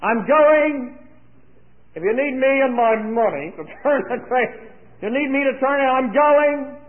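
high, emphatic declaration, loudest on GOING; loud call. Retold or imitated. Father re-enacting his answer to his daughter, called loudly. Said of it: "I called loudly and said".

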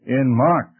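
ordinary delivery

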